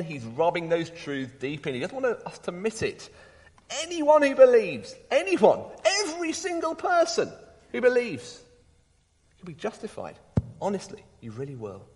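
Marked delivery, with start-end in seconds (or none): 3.8-8.4 higher and louder on anyone; rising preacher's insistence; emphatic build. Real, not acted. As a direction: press every anyone home. Preacher stressing everyone's inclusion.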